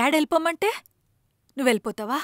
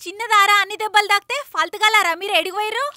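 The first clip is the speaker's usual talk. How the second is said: higher, sharp scolding of the friends; scolding mother; 8 semitones higher, 3 dB louder than usual. Acted. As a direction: scold them in a raised voice. (కోపంగా తిట్టడం)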